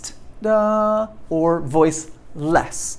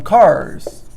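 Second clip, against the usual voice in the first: "cars." louder, buzzing the final z; z ending stressed; 5 dB louder than usual. Shown as a demonstration. Teacher repeating an example word. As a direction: land on the end of "cars.". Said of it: "So these are all Z sounds".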